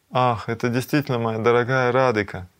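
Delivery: usual speaking voice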